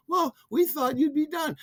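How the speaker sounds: very high, sheepish sister voice; sister's excuse. Retold or imitated. The sister answers her angry brother, high and meek.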